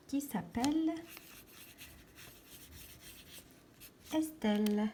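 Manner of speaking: broken up by a long pause; halting dictation at writing pace; dictating while writing. Shown as a demonstration. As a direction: say it in pieces as you write. Saying words while writing them.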